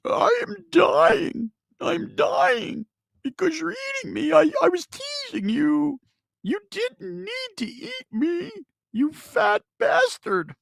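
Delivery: very high, loud, slow dying wail; a burrito wailing its last words as it's eaten. Acted. Burrito being eaten on air cries out, very high loud slow wail. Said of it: "it's going".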